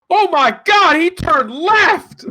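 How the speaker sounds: high, very loud outraged shout, last word drawn out; mock play-by-play outburst at full volume; race commentator yelling. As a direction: holler like an overexcited shoutcaster. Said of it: "just yell at me".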